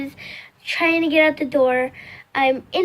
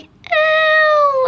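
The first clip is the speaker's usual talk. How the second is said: high, louder squeal through tears; a sobbing squeak while hopping; crying whimper; 13 semitones higher, 5 dB louder than usual. Retold or imitated. Hurt girl hopping out the door, high crying whimper. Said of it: "like, crying".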